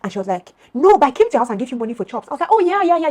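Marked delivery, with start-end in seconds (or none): 0.7-2.3 raised, higher voice of an arguing friend; imitated insistent protest. Friend insisting she already paid, loud imitated protest. 2.5-3.1 loud, high burst of agreement; acted sudden recall. Storyteller suddenly remembering the payment, loud excited recall.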